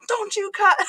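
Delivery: high, stern scolding; mother rebuking her kid for cussing; mom's sharp rebuke. Retold or imitated. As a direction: snap it at the kid like a fed-up mother. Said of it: "And she's like".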